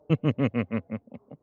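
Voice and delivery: loud chuckle trailing off; sly chuckle. Acted. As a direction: chuckle at your own risqué joke. Amused by his own euphemism.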